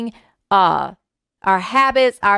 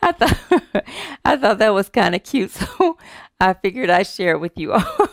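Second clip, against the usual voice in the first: chuckling through the words; real giggles breaking up the sentence; laughing. Real, not acted. Laughing at own joke.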